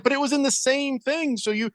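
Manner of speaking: shrill up like an outraged wife; mock scolding wife. Wife catching him eating moldy cheese, mock high scolding. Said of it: "She's like".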